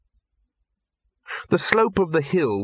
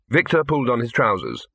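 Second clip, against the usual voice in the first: usual speaking voice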